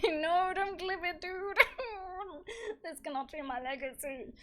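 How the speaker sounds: high, loud wail through laughter; mock-distressed pleading. Real, not acted. Streamer begging viewers not to clip it, wailing.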